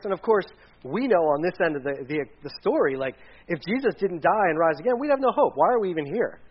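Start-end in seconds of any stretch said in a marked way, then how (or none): none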